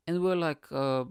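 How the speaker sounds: usual speaking voice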